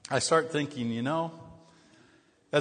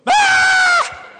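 sudden very high, loud shriek of alarm; imitated startled shriek; 14 dB louder than usual. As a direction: do the wife's panicked shriek from the passenger seat. Wife shrieks while he drives.

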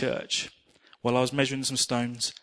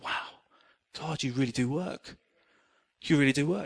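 awed hush; 6 dB quieter than usual. Retold or imitated. Awed prayer of thanks.

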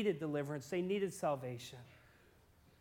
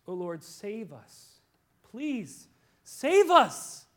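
pleading cry; 3 semitones higher, 7 dB louder, much slower than usual. Retold or imitated. Pleading prayer building to cry.

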